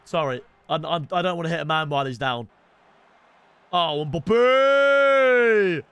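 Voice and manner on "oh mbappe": long, loud football-fan holler; high, ringing goal cry; celebratory name shout. Real, not acted. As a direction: stretch the name out as you cheer. Calling out the player in celebration.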